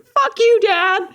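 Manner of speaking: very high-pitched mock yell; hammed-up angry kid at a parent; mock outrage. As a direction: play-act defiance in a high voice. Acts out angry reply to dad.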